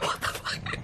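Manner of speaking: breathy whispered what the fuck; whispered disbelief. Retold or imitated. Group stunned by the stranger's apology, whispering disbelief. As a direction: hush it out, stunned.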